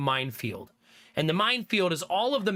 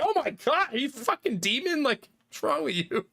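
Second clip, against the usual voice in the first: shocked; 7 semitones higher than usual. Retold or imitated. Shocked reaction to son's voice.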